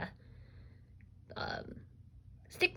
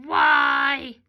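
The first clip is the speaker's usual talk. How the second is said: loud, raised monster voice; 4 semitones higher, 10 dB louder than usual. Shown as a demonstration. Teacher reading sight words with kids, monster voice. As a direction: bellow it like a monster. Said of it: "use our monster voice".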